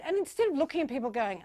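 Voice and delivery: ordinary delivery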